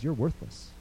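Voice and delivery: low, soft whispered accusation; lightly voiced tempter, murmured low; Satan's voice. Satan whispering to the listener that they are worthless, soft and low. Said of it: "Satan gets on your lap and says".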